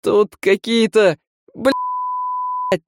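high, slow scream of fright; terrified cry. Acted. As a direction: cry out as you're knocked down. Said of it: "Юнец вскрикнул".

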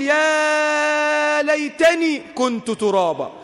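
high, drawn-out mock wail; slow acted outcry of regret; imitated regretful scream. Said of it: "عمال بيصرخ بيقول".

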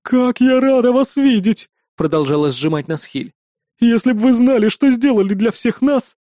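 high, effusive character voice; gushing innkeeper. A narrator's reading. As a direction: gush with gratitude as you hug them. Innkeeper gushes while hugging.

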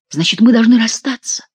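usual speaking voice

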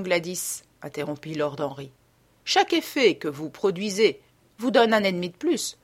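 ordinary delivery

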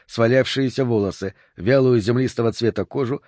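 usual speaking voice

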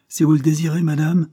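sec, brusque, plus haut et plus fort; ton sec et brusque. A narrator's reading. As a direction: coupe chaque mot, sans chaleur. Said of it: "d'un ton sec et brusque". (la vieille fille accueille froidement sa belle-sœur, ton sec)

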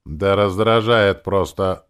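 loud, drawn-out grumbling; peevish demon. A narrator's reading. Demon nags the hero about his words, peevish. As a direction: snap back querulously, stretching the words. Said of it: "сварливо заговорила демон".

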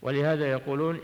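normal talking voice

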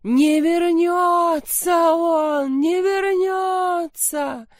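very slow, high, loud wailing; howling wail. Acted. Milena howls that he will never return. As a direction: howl it out hopelessly. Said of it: "Миленка всё выла".

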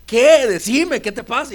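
loud, high panicked question; husband yelling into the phone at his wife; alarmed shout. Retold or imitated. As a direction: demand an answer in fear. Frantic call on phone.

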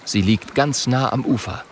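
ordinary delivery

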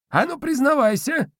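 put-on high, teasing old spirit; squeaky old man, grinning; domovoi voice. A narrator's reading. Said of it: "улыбнулся старичок".

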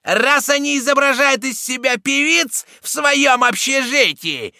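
very high, loud, stretched yell; Цибуля shouting. A narrator's reading. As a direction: screech it mockingly to the gang. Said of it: "кричала Цибуля".

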